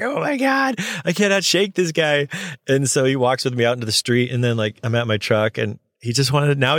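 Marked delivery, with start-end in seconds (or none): 0.0-2.3 high-pitched inner complaint, retold; hammed-up despair at being stuck with him; exasperated.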